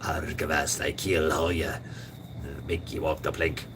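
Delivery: lightly voiced pirate threat; mock pirate threat. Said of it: "talking like that".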